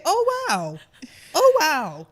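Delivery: loud, high, overdone mock wow; hammed-up polite astonishment, pitched high; feigned amazement. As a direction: cover disapproval with a big wow. Acts polite reaction to unattractive photo.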